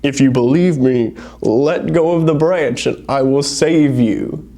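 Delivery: slow, lightly booming voice from above; a hint of a voice from heaven. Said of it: "the voice says".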